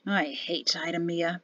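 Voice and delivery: hushed resentful aside; under the breath. A narrator's reading. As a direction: mutter it privately. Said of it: "said under his breath".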